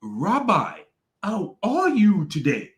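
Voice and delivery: overly polite, rehearsed-sounding; mock rehearsed greeting. Imitating the guest greeting the Rabbi, fake and sweet. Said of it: "he was like".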